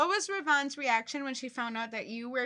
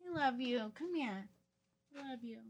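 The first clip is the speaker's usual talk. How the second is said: softer, fading loving call; quiet imitation of the late friend; remembered tender voice; 11 dB quieter than usual. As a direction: murmur it like a memory. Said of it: "I hear Ravon".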